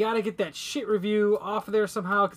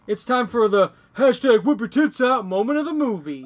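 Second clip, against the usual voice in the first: announcer voice; 4 semitones higher, 5 dB louder than usual. Pretend. Mock announcer hashtag moment.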